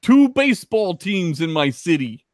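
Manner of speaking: put-on slow, high Chicago accent; broad Chicago local voice. Continuing the Chicago accent bit.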